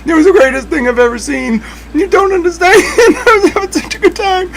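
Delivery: can barely get it out for laughing; laughing hard. Real, not acted. Retelling friend, breaks into laughter.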